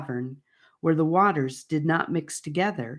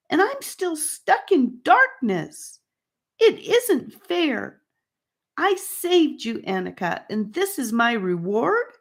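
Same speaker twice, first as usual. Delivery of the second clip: rising rant; 7 semitones higher than usual. A narrator's reading. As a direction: let the self-pity grow into a high rant. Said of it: "as he ranted, his voice getting louder and louder".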